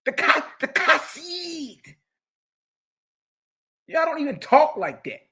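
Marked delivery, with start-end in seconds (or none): 0.1-2.0 Kasid with a throaty choked K, high and slow; mocking guttural Kasid. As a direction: gag on the K in Kasid. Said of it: "they choke on the K's".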